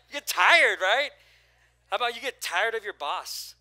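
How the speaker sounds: big shouted preacher's question; preaching at full force. Acted. Pastor to church, shouting the question. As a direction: drive it home to the congregation.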